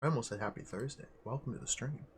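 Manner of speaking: normal talking voice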